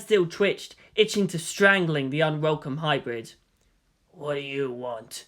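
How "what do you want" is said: soft, slow and menacing; low, quiet threat; hissed. A narrator's reading. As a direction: hiss it through clenched anger. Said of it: "he hissed".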